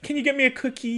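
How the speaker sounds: put-on high, soft little-old-lady plea; sweet old lady ask. Grandma sweetly asking Bonnie for a cookie, high and soft. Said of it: "She was like".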